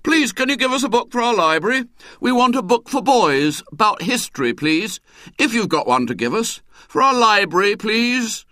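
high, bright eager schoolboy; brightly. Acted. William asking again for a book, bright high voice. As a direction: chirp the request brightly. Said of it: "William spoke up brightly".

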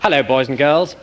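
plummy English storyteller voice; posh public school accent. Acted. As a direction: read it like a posh BBC announcer. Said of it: "Uncle Remus did not have a Poncy public school accent".

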